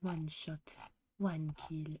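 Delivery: low, soft assassin catchphrase; cool, quiet game voice line; quiet character line. Acted. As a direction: say it low and hushed, in two parts. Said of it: "how she says it".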